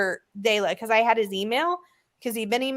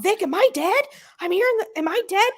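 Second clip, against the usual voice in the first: confused yelling; 12 semitones higher than usual. Retold or imitated. Dad yelling at mom from the hospital bed. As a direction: yell in a panic, half-awake. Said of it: "yelling at my mom".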